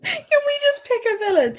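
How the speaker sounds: high, squeaky parody of her friend; playful high-pitched mockery; mock whiny echo. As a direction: squeak it back as a teasing mimic. Mocking S0's complaint back.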